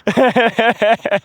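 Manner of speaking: a high, loud burst of bashful laughter; embarrassed laugh. Real, not acted. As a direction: laugh it off, caught out. Laughs at own confession.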